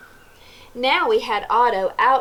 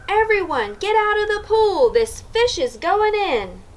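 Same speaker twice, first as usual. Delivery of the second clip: yelled; 9 semitones higher than usual. A narrator's reading. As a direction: clear the pool, shouting. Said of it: "The firemen yelled".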